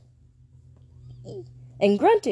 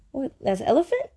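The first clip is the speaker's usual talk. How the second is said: ordinary delivery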